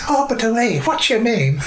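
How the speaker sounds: put-on high, loud Irish accent shout, laughing. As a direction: call up the stairs in a strong Irish accent. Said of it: "shouting in a strong Irish accent".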